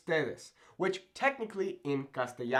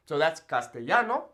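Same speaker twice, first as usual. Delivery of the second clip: normal talking voice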